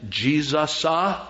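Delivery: mock drawn-out "Jesus" with "uh" tacked on; preacher's "uh" tic. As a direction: stretch "Jesus" and add an "uh" at the end. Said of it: "starting to say, "uh," at the end".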